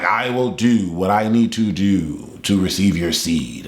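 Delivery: low, measured vow of devotion; Code. Retold or imitated. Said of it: "So he's like".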